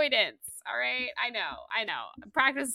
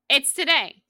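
higher and louder, TODAY stressed; excited emphasis; 6 semitones higher, 6 dB louder than usual. Shown as a demonstration. Host insisting the announcement comes today, excited.

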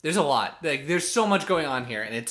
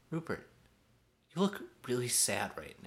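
imitated gentle host; 2 semitones lower, 10 dB quieter than usual. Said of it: "then he goes to Rupert".

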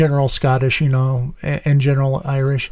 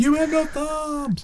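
much higher, softer and slow cartoon squeak; 11 semitones higher, 6 dB quieter, slower than usual. Echoing a cartoon line.

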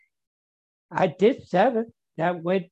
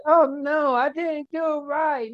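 high, dismayed self-quote; recalling his own panic at getting it wrong; dismayed cry; 8 semitones higher than usual. Retold or imitated. Mark quoting his own dismayed outburst, pitched up. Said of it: "And I carry the defense said".

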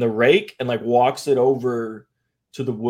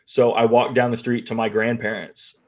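ordinary talk, nothing marked

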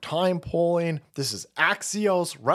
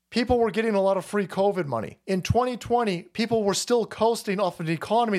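ordinary delivery

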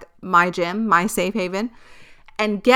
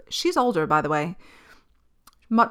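ordinary talk, nothing marked

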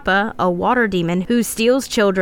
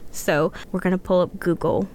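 ordinary talk

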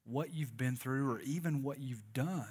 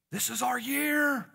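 mock fan cheer, much higher; a hammed-up hopeful fan; 12 semitones higher, 6 dB louder than usual. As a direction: cheer "This is our year" high and bright like a hyped fan. Mocking hopeful football fans.

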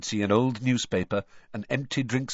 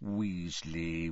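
muttered to himself; 5 semitones lower, 8 dB quieter than usual. Acted. Muttering while checking list.

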